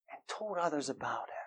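sudden breathy whisper, almost no voice. Acted. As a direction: drop to a hushed whisper for emphasis. Preacher drops to a hush.

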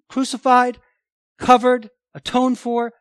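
rising emphasis. Real, not acted. Preacher listing with rising force.

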